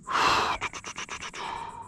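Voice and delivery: weary sigh. Real, not acted. Stuck in grass, frustrated.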